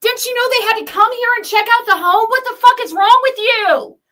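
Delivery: high, loud exasperated yelling; furious, rising disbelief; angry rant. Real, not acted. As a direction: hammer her with the facts. Renewed angry rant at critic.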